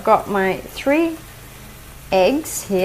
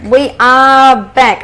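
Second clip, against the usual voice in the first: slow loud welcome back; 3 semitones higher, 6 dB louder, much slower than usual. Real, not acted. Cheerful show return announcement.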